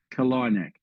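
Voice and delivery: Kalinack said ka-LI-nack, the second syllable stressed. Shown as a demonstration. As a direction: put the weight on LI in Kalinack. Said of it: "emphasis on the second syllable".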